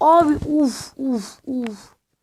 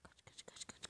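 whispered, much softer urging; urgent whisper; 35 dB quieter than usual. Real, not acted. Gamer whispering to his partner to flee, urgent whisper. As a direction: hiss the word to run.

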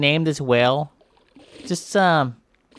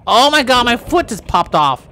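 alarmed; 8 semitones higher, 6 dB louder than usual. Pretend. Foot popped off.

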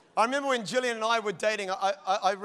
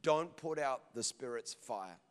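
lower, slow and solemn; Holy Spirit speaking; 7 semitones lower, 7 dB quieter, slower than usual. Retold or imitated. Holy Spirit speaking to the pastor, low and slow. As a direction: deliver it like a divine command, slowly. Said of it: "this is what the Holy Spirit said".

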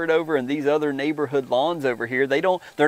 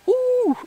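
excited whoop. Real, not acted. Preacher excited by his point.

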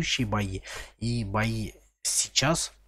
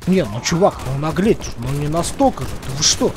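indignant outburst; 3 semitones higher, 7 dB louder than usual. Real, not acted. Complaining at enemy.

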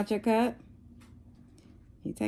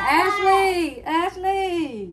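high, drawn-out mock scream of a name; imitating toddler's screaming; 11 semitones higher, much slower than usual. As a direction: mimic a little kid hollering through the house. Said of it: "he be running around the house screaming".

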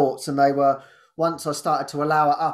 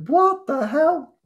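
much higher, drawn out slowly; amazed disbelief; 13 semitones higher, much slower than usual. Retold or imitated. Hearing others felt the same, slow high astonishment. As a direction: stretch it in wonder.

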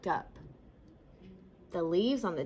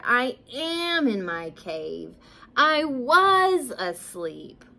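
irritated bear, building louder and slower; grumbled; 3 semitones higher, 5 dB louder, slower than usual. A narrator's reading. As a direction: grumble with drawn-out emphasis, woken from sleep. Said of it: "grumbled Bear".

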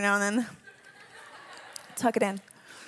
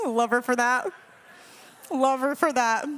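laughing. Real, not acted. Laughing fondly about daughter.

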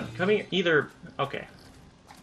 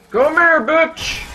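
shouted taunt; 12 semitones higher, 6 dB louder, slower than usual. Real, not acted. Taunting boss during fight.

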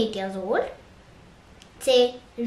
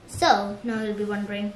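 ordinary talk, nothing marked

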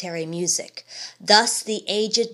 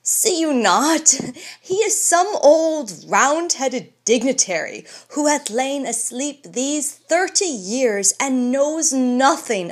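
higher, amused sneer; mocking scorn; 5 semitones higher, 3 dB louder than usual. Bullivant sneering at the old man, mildly amused. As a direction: poke fun at the old relic. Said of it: "said Bullivant, laughing".

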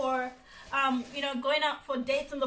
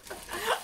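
a quick, higher little laugh; short giggle; 8 semitones higher than usual. Real, not acted. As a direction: giggle at herself before admitting it. Laughs at own thrift.

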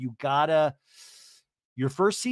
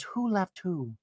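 lightly hammed-up concern, softer and higher; 4 semitones higher, 5 dB quieter, slower than usual. [name] asking Katie about the breakup, mock concerned. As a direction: imitate a concerned friend prying gently. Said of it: "This is my concerned voice".

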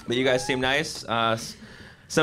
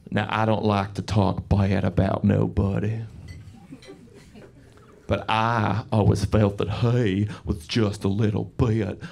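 put-on low, slow Southern drawl of a frail old woman; old Alabama lady; 7 semitones lower, slower than usual. Frail old lady in Alabama gossiping gently, slow Southern drawl. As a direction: savor every syllable like an old Alabama lady. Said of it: "this old lady, she was, like, frail".

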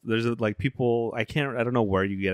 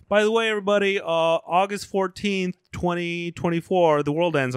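church announcer; 7 semitones higher than usual. Retold or imitated. Church tells everybody the date of doomsday, mock announcement. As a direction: casually announce doom to the congregation, pitched up. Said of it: "church is just like".

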